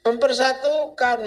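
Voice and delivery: normal talking voice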